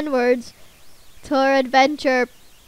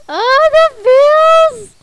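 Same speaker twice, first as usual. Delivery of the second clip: high, loud emotional outburst; overcome with emotion; 11 dB louder than usual. Real, not acted. Player overwhelmed by the sad goodbye, high and loud. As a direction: burst out, nearly tearful. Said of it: "I'm literally about to cry".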